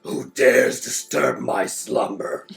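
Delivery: menacing apparition voice. Acted. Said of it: "it's gonna be like".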